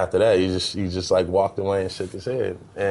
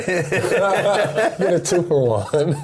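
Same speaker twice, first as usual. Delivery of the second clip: said through real laughter, slowly; laughing; 11 semitones higher, much slower than usual. Real, not acted. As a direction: laugh the words out. Laughing at his own joke.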